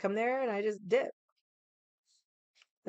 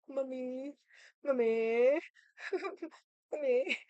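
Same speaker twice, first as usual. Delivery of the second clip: put-on higher, whiny little-kid calls; kids calling mommy; 6 semitones higher, much slower than usual. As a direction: call out like a needy child. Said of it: "They're always coming to me".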